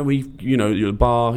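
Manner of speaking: ordinary talk, nothing marked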